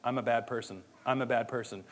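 low, soft, mopey self-talk; a hint of gloomy self-condemnation; self-pitying mope. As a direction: mumble it like wallowing in guilt. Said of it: "sit on it and be like".

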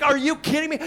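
high, loud mock disbelief; incredulous comedian. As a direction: ham up the comic's outrage. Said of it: "he's like".

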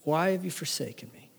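soft, forsaken question; subdued plea, barely projected; hushed lament. Acted. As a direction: ask it softly into silence. Repeats cry of forsakenness.

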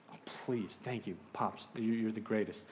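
soft, grateful undertone; relieved aside. Retold or imitated.

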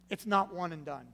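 ordinary delivery

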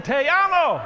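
high, loud shout; rousing crowd cheer. Acted. Pastor rallying all the Latinos in the house, rousing shout. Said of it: "all the Latinos in the house said".

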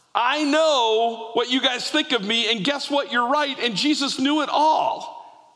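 a villager laughing through her excited confession; joyful testimony. Retold or imitated. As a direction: tell them the news you can't hold in.

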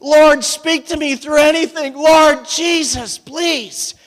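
mock-earnest plea to the Lord; hammed-up praying for a hockey win; pleading prayer. Playful prayer for his team.

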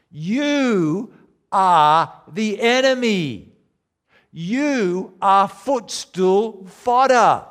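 preacher driving the blame home to the crowd; preaching at full force. Acted. Preacher accusing his listeners, building to loud slow peaks.

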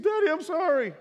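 put-on sorry little boy. Father imitating his son running to hug him, high and pleading. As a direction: pipe it up like a small kid apologising.

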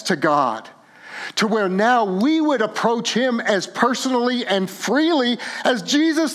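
high, loud, fervent preaching; full-force sermon climax; impassioned preaching. Real, not acted. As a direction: preach it out with rising fervor.

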